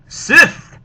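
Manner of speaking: high, loud one-word shout; dramatic storyteller's exclamation; alarmed cry. Acted. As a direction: shout it as a sudden warning. Sudden alarm in read-aloud story.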